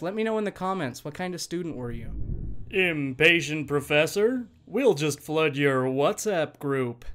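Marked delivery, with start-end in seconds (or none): 2.7-7.0 teaser voice. Acted. Voiced promo teaser line.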